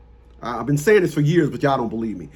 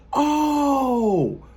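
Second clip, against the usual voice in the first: 1 realizing gasp; 7 semitones higher than usual. Retold or imitated. Girlfriend's realization.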